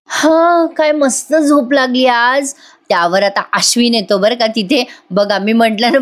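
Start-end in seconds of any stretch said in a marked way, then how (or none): 0.1-2.5 a slow, higher, luxuriating sigh of comfort; contented stretch. Retold or imitated. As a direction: savour the long sleep, drawn out.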